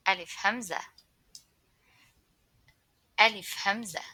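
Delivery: carefully articulated letter name; clear teaching model. Shown as a demonstration. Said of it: "repeat after me".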